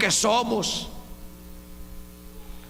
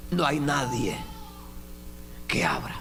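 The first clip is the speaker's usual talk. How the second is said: lower, softer, ending in a whisper; hushed warning; 5 semitones lower, 4 dB quieter than usual. Acted. Preacher warning of the closed door, low and whispered. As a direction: drop to a whisper on que abra.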